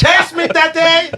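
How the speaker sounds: high, drawn-out mock shout; kids' playful screaming. Child to dad in the bedtime game, high playful shout. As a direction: imitate an excited child yelling to be chased. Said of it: "They all screaming".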